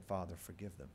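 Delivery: soft, low dying prayer; hushed prayer. Acted. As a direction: breathe it out as a last prayer.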